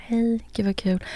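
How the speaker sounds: soft, flirty whisper. Shown as a demonstration. Voice message to a match, flirty whisper. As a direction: whisper it like a sweet come-on. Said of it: "när jag då också ställer om rösten lite så här".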